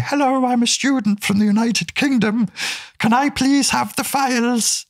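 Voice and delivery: very high-pitched, hammed-up British student; polite British student. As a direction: plead sweetly in a squeaky put-on British voice. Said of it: "you have a British accent".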